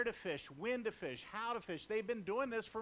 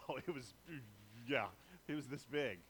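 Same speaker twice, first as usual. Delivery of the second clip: fisherman fibbing; 7 semitones lower, 4 dB quieter than usual. Acted. Fisherman bragging about catch.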